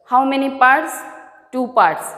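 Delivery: ordinary talk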